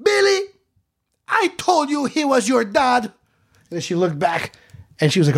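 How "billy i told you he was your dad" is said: high, loud public announcement; loud staged yell. Retold or imitated. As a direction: yell so the nun ten feet away hears. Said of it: "she yelled it loud enough".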